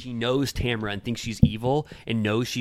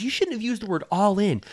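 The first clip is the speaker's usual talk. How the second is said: mock accusation; 9 semitones higher than usual. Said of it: "She goes".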